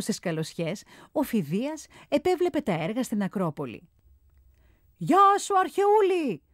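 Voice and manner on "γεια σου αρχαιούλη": high, loud call from up on the scaffold. A narrator's reading. Phidias calls down from the scaffolding, high and loud. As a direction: shout a greeting from far above. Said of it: "φώναξε".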